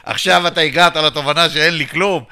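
loud, laughing mock exasperation; laughing loudly. Mocking the treasurer for finally getting it: loud laughing. Laughing at his own broke state.

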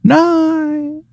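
very high, long-held faraway cry; mock distant yell. Burglar answering from the river, mock far-off yell. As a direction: hold it high, as if calling from downriver. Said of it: "You hear him yell".

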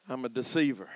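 low, hushed admission; reluctant confession. Acted. Jacob admitting his name to God, low reluctant confession. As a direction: own up to it quietly.

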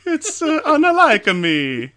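high, slow exaggerated Italian accent. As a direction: ham up an Italian accent denial. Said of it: "speak in your Italian accent again".

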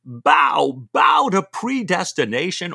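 loud, high bellow of Bow, bow; shouted command. Acted. Stephen orders Jack to bow to predestination, shouted high.

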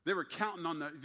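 ordinary talk, nothing marked

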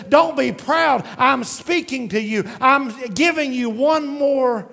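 loud, high pleading call, slowing at the end; God raising his voice. Retold or imitated. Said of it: "God lifts his voice and says".

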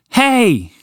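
high, drawn-out call to get attention; shouted call. Acted. Walter confronting an enormous horse, shouted call. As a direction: call out high and sharp, falling away. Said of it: "he shouted".

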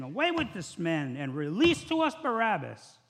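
loud, rising crowd demand; loud mob cry for Barabbas. Acted. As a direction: shout it like the mob. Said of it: "they cried out at once".

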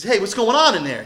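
loud acted call, "on" pitched high and falling; older son calling out to the party. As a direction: shout a question toward the house.

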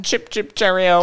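high, sing-out mock British; British accent. Hosts playing at being British for their Grand Prix, mock British accent. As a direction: put on a playful British accent. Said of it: "British accent the entire time".

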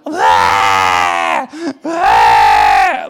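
loud wailing imitation of a crying toddler; preacher mocking crying supporters with a loud wail; mock screaming cry. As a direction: wail like a little three-year-old. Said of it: "they're just screaming".

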